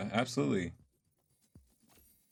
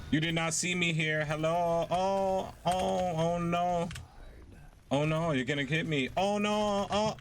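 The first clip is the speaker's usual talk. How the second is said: playful panic; 8 semitones higher than usual. Real, not acted. Playful panic while playing Reaper.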